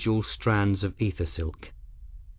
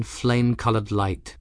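ordinary delivery, nothing marked

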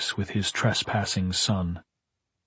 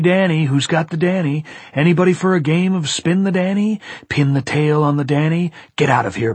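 taunting voices; 5 semitones higher, 5 dB louder than usual. A narrator's reading. Hotel's mocking voices taunt.

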